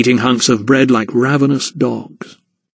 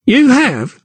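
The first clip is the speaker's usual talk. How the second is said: much higher sneering crookback; mocking; 12 semitones higher than usual. A narrator's reading. Crookback mocks the count, high scoff. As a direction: scoff at the claim. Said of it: "mocked the crookback".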